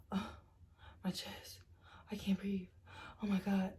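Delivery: breathless fake-dying gasps, dropping to near whisper on chest; mock gasping heart attack. Boyfriend faking a heart attack to stop the breakup, gasping. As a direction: ham up a fake heart attack, clutching for air.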